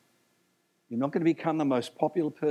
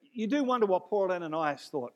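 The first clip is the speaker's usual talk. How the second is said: ordinary talk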